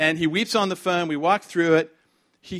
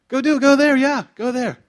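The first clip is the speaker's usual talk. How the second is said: urgent calling out; 11 semitones higher, 11 dB louder than usual. Real, not acted. Preacher urging listeners to go.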